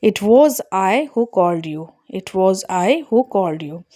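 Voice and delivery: very slow, I given weight; stress on I. Shown as a demonstration. Teacher slowly modelling the cleft for students. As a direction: pace out every word, hitting I. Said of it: "subject को जोर देकर बोल रहे हैं".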